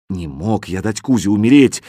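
usual speaking voice

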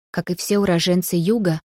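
plain reading voice

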